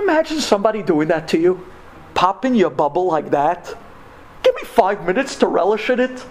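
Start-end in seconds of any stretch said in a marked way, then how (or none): none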